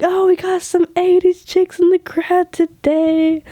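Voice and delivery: high, bright stage announcer voice; singer's stage shoutout. Retold or imitated. Singer greeting the crowd from stage, high upbeat call. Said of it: "She was like".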